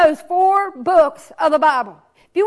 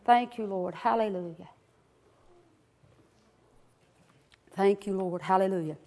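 soft, low murmured praise; quiet prayer; 9 semitones lower, 9 dB quieter than usual. Real, not acted. Preacher murmuring prayer to the Lord, soft and low. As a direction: pray quietly before the sermon.

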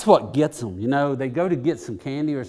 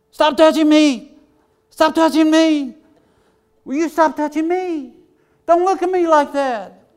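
put-on much higher, loud whiny child shouting; whiny kid imitation; 13 semitones higher, 8 dB louder than usual. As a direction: mimic squabbling siblings, high and shrill-loud.

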